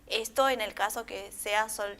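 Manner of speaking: usual speaking voice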